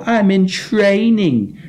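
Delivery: high, drawn-out mimicry of a drunk friend; friend's drunken whine. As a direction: stretch it out high, mocking his drunken boast. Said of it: "That's how he talks".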